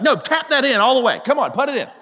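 hammed-up pushy parent at mini golf; mock-insistent coaching. Acting himself coaching kids at putt-putt.

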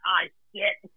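mock panic. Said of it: "I was like".

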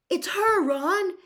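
high, loud excited shout. A narrator's reading. Harry shouting to Ron when the girl is chosen, loud and high. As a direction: yell it to your friend over the noise. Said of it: "Harry shouted".

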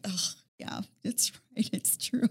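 soft, half-whispered owning up; mock-sheepish, barely voiced confession; sheepish admission. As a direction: concede it under your breath. Retold sheepish admission to dad.

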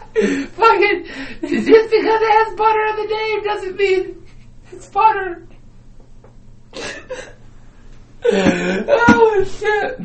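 real helpless cry-laughing; crying with laughter. Real, not acted. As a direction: lose it laughing to tears.

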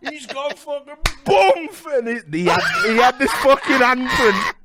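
high, loud and laughing; telling the best bit, almost shouting; high, loud excited retelling. Real, not acted. Excited peak of slap story.

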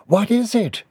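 high-pitched questioning character voice; high, curious query; curious woman. Acted. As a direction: ask in a high voice, falling at the end.

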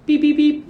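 high, loud electronic beeps; thermometer beeps. Retold or imitated. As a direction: imitate a beeping thermometer loudly, high up.

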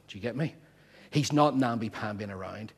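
ordinary talk, nothing marked

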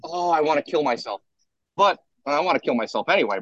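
normal talking voice

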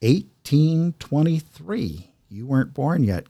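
ordinary delivery

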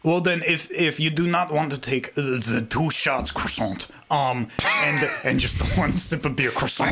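put-on French accent. Co-host offering a new drink rule, mock French accent. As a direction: bargain in a badly faked French accent. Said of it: "Are you saying croissant just to make it French?".

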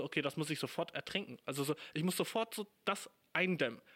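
ordinary delivery

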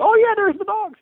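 squeaky high oh yeah; high surprised relief. Retold or imitated. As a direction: pipe it up in surprise. Relieved reaction, high far voice.

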